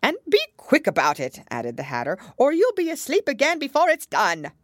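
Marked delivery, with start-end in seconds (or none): 0.0-1.4 loud, high Hatter order; impatient Hatter. A narrator's reading. Hatter hurrying the Dormouse, impatient. 2.4-4.5 loud, high Hatter order; impatient Hatter. A narrator's reading. Hatter hurrying the Dormouse, impatient.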